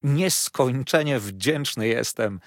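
slow, heartfelt thanks, lightly voiced; a hint of a delighted young man; overjoyed thanks. A narrator's reading. Traddles thanks Peggotty for buying furniture, overjoyed. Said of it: "nie posiadał się z radości".

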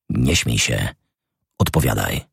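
low, cold command; menacing Lupin. A narrator's reading. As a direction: press the boy to answer. Lupin threatens Botrele. (niski, groźny rozkaz)

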